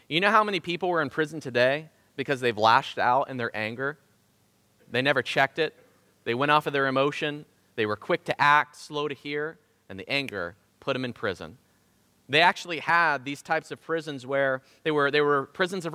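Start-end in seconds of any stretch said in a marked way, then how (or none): none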